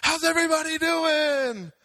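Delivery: high, loud imitation of a hype-up shout; preacher's rousing greeting. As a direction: call out to a whole crowd, mimicking your friend. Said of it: "he goes".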